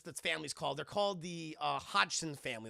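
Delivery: normal talking voice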